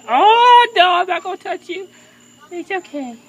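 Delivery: high, soothing coo to a bird; coaxing a wild bird. Real, not acted. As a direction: reassure the frightened bird gently.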